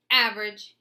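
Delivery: loud jeering taunt. A narrator's reading. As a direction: jeer it out loud. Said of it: "he jeered".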